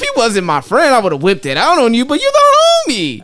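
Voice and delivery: loud, high excuse climbing to a shout; hammed-up protest. Man excusing himself to a friend-zoned girl, hammed-up protest. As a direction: plead loudly, shooting up at the end. Acting out letting her down loudly.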